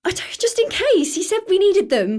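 fighting tears, words tumbling out; distressed. Acted. Girl explaining to neighbour why her dad left, upset and high.

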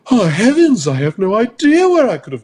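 high, prim mock innocence; rich lady voice. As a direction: play the dainty rich lady feigning ignorance. Mocking rich woman's innocent reply.

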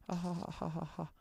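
high, flat mock laugh; fake laugh track. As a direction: stiff canned studio laughter. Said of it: "'Cause it's a laugh track".

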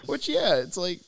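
high excited preaching. Real, not acted. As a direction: gush about God. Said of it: "I get excited when I talk about Jeebus".

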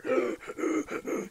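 sharp high wounded gasp for air; heavy breathing. Shown as a demonstration. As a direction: gasp like a shot lung. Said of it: "you're breathing heavy from being shot".

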